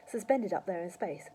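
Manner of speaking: usual speaking voice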